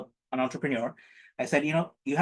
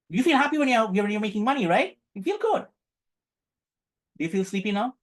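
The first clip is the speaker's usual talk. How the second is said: pitched higher, buoyant encouragement; cheerful pep for the class; bright and upbeat; 7 semitones higher than usual. Real, not acted. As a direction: lift them up, sound happy.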